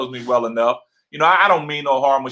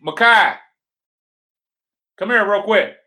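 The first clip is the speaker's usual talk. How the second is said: louder, higher call to a son; 5 semitones higher, 3 dB louder than usual. Real, not acted. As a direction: call him over from another room. Calling son to come over.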